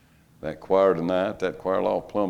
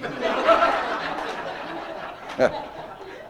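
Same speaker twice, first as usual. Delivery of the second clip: high, airy wheezing laugh at his own joke; high breathless laugh; 14 semitones higher, 3 dB quieter than usual. Real, not acted. Preacher cracking up at the old man joke, wheezing laughter. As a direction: break into a high silent laugh.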